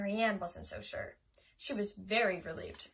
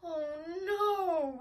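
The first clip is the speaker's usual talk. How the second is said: wailing; 6 semitones higher, 4 dB louder than usual. A narrator's reading. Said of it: "wailed Karen".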